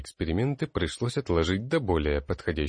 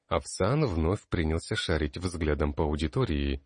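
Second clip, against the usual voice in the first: plain reading voice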